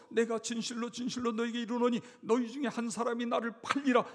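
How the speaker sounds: loud, high, solemn pronouncement; solemn proclamation. Acted. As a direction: declare it like a prophecy. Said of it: "예수님께서 ... 이런 말씀을 해요".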